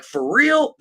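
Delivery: high-pitched retold astonishment; mock disbelief. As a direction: imitate gullible cops, voice jumping up. Acts out cops' disbelief.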